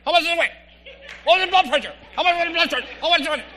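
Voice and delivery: high, loud, rapid-fire nosy wife voice. Retold or imitated. Wife at the doctor's office grilling the doctor, high and pushy. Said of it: "She's always right there".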